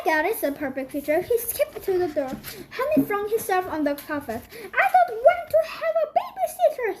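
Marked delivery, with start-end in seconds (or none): 4.6-7.0 much higher, strained wailing voice. Acted. Honey flung on the carpet to his parents, high wail. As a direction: wail it like a spoilt child. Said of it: "he wailed".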